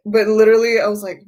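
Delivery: usual speaking voice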